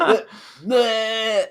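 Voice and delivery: high, loud taunting bleh; jeering drawn-out raspberry-like noise; mocking bleat. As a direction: imitate a childish gotcha taunt. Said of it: "Yeah, he's like".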